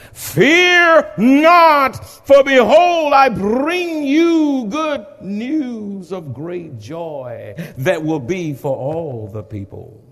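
angel's words rung out high and loud; booming pulpit proclamation; preaching at full force. Acted.

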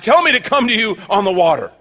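loud, high call across the water. Acted. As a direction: call up to the Lord at full voice. Peter calling to Jesus on water.

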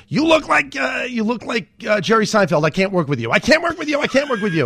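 strained high-pitched caricature repeating the rejection; Garry Shandling impression. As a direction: ham up the boss brushing him off. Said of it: "and he goes".

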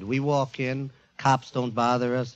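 ordinary talk

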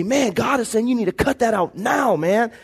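passionate warning. Retold or imitated. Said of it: "be very passionate".

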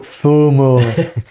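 FOMO drawn out slowly in imitated Hull vowels; mock Hull accent. Host teasing co-host's slip, mock Hull accent. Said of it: "I think you meant to say".

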